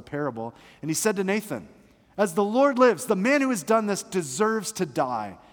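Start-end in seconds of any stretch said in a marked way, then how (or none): 2.2-5.4 mildly angry, higher and louder vow; kindled anger. Acted. David condemning the rich man to Nathan, kindled anger. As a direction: pronounce judgment with a touch of royal outrage. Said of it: "David's anger was greatly kindled".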